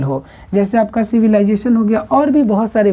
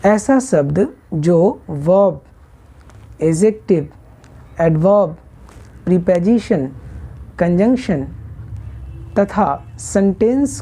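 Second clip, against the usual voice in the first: very slow, each term set apart by long pauses; slow repeat; 3 semitones lower, slower than usual. Shown as a demonstration. Teacher to students, repeating the list slowly with pauses. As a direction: spell the list out slowly so it sinks in. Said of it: "ध्यान से फिर से सुनिएगा".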